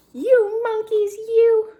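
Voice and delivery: a cross peddler telling them off; scolding peddler. Acted. Peddler scolding the cap-thieving monkeys, loud and cross.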